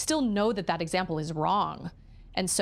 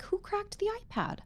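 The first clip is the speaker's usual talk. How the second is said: high questioning mom; 5 dB quieter than usual. Retold or imitated. Mom asking kids about iPad.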